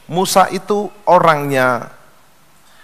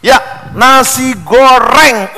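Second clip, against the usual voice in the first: proud, drawn-out order; 7 semitones higher, 4 dB louder, much slower than usual. Acted.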